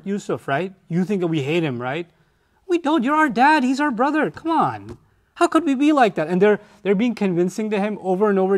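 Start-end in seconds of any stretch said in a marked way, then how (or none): 2.7-6.3 high, insistent mock protest; protesting sons. Brothers assuring their father they love Yusuf, high protest. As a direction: protest your innocence to Dad.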